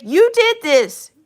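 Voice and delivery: sing-song blame thrown back at them; mocking accusation. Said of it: "It's like".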